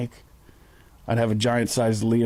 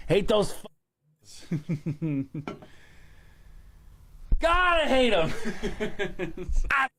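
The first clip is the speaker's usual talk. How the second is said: cursing through helpless laughter; high giggling curses between laughs; laughing; 9 semitones higher, 4 dB quieter, much slower than usual. Real, not acted. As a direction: break up laughing mid-rant. Laughing through Celtics rant.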